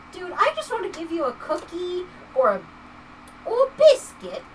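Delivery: hammed-up British lilt; horrible British accent. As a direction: put on a motherly, bad British accent. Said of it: "Sorry for the horrible British accent".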